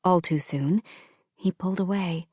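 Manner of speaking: usual speaking voice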